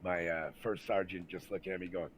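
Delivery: usual speaking voice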